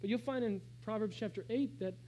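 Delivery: ordinary talk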